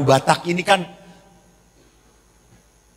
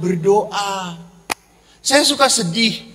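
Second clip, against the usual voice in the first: ordinary delivery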